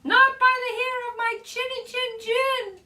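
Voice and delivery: high-pitched storybook piglet; sing-song high refusal from a little pig. Acted.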